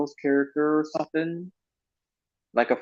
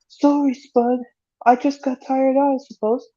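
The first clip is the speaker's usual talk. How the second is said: Travis apology; 11 semitones higher, 3 dB louder than usual. Retold or imitated. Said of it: "It goes like this".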